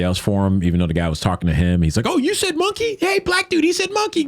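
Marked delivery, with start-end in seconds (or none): 2.1-4.3 very high, put-on whiny instigator voice; a sneaky instigator stirring up a fight; mocking the coward. Imitating coward egging someone on.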